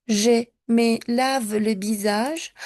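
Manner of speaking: slow, stressed me; slow model. Shown as a demonstration. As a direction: model je me lave slowly. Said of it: "qué pasa cuando". (je me lave le visage, lentement)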